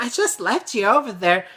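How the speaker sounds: high imitated mother voice; defensive mom. Mom explains to angry son, high defensive voice. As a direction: protest as a helpless mom.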